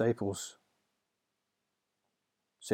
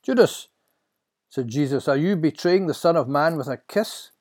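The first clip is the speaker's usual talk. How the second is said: higher, louder questioning voice; reproachful Jesus; 6 semitones higher, 7 dB louder than usual. Acted. Jesus to his betrayer at the arrest, reproachful.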